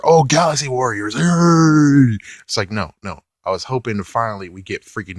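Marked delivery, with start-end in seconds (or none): 0.0-2.2 higher, louder sarcastic yay; sarcastic fan cheer; mock cheer. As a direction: fake excitement, hammed up. Mocking fake cheer for show.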